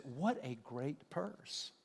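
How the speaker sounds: slow, drawn-out, gushing compliment; mock admiring. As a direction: stretch it out like an impressed friend. Admirer praising a new purse.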